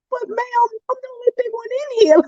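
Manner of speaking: high, fast, nervous pleading; high-pitched retold nervous protest; scared plea. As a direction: blurt it out, frightened. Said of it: "I was so scared".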